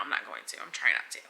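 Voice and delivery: real choked whisper fighting tears; holding back tears. Real, not acted. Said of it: "Like, I could cry right now".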